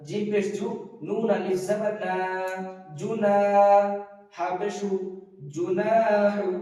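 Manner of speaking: short "জু", long-held "না"; measured spelling drill drawing out the middle of "জুনাহু"; madd on nun. Shown as a demonstration. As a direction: stretch "না" in "জুনাহু", leaving "জু" short. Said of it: "নুনটা হবে আলি-, আলিফ মাদ্দা".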